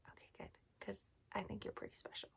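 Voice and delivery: soft secret whisper. Acted. Whispered to child.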